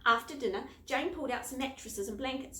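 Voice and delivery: plain reading voice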